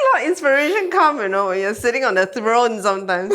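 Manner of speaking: laughing. Real, not acted. Joking about the toilet.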